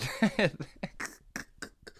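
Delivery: real burst of laughter. Real, not acted. Speaker cracks up at his own wordplay, laughing. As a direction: crack up, high at first, then tailing off.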